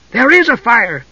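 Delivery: high, bright cry; eager exclamation. A narrator's reading. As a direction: jump in with glee. Tass excitedly insists.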